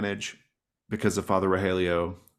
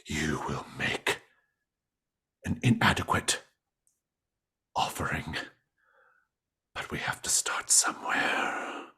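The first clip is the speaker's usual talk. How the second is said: eerie whisper. Acted. GM voicing the creature to the player, eerie whisper. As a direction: breathe the words out like a presence in the dark.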